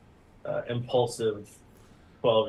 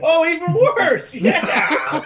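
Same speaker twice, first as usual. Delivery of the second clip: loud laughing cry, pitched high; 9 dB louder than usual. Real, not acted. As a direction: burst out laughing while shouting. Table erupts at bad roll.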